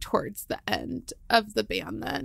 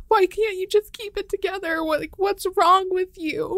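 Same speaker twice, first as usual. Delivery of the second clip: higher-pitched scolding of her own self, retold; berating herself in a raised voice; mad at herself; 7 semitones higher, 3 dB louder than usual. Wife retelling how she scolded herself, raised and angry. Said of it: "I would get so mad".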